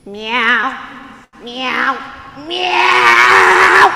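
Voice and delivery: cat courting call. Acted. Gato showing Martina how he would talk, escalating meows. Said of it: "I would talk to you like this".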